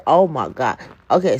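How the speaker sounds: ordinary talk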